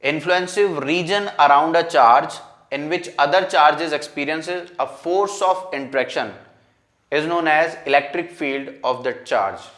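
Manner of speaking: very slow, word-by-word dictation. Real, not acted. Teacher repeating the definition for students' notes, dictated slowly. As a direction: read it out slowly so they can write. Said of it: "pause करके लिख लेना".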